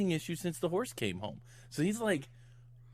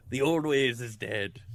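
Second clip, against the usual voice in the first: put-on slow, gruff old Irish man intoning it; lightly voiced old Irish man. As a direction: say it slowly like a weary old Irishman. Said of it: "say it like an old Irish man".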